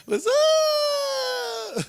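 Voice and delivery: very high, long-held squeal; drawn-out high greeting. Acted. Greeting a brother in the crowd, mock squeal. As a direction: stretch the last word out way up high.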